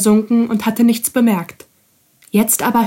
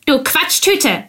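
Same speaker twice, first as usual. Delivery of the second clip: higher, slow sudden outburst; blurting out; 6 semitones higher than usual. A narrator's reading. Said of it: "platzte die Ratte heraus".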